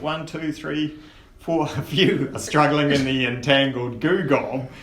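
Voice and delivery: normal talking voice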